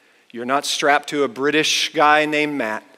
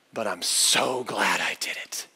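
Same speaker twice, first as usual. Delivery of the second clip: low, soft and slow, full of relief; acted tender confession in a hushed voice; heartfelt quote; 6 semitones lower, 5 dB quieter, slower than usual. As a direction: breathe it out tenderly, lingering. Daughter's heartfelt words in embrace.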